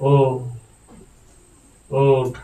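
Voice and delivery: normal talking voice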